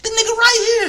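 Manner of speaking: high, loud disbelieving cry; shocked yell. Real, not acted. Gamer yelling at the open receiver, shocked. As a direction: point and shout at the screen.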